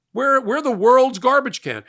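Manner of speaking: high, loud, slow indignant cry of the quoted voters; outraged cry. Retold or imitated. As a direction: throw it out in outrage, slowly.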